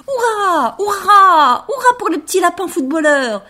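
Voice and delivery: loud high cheering crowd. Acted. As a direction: cheer for the little rabbit. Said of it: "tout le monde cria".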